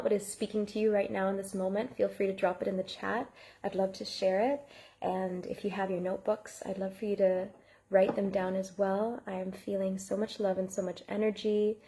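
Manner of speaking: ordinary talk, nothing marked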